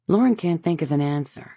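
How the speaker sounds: plain reading voice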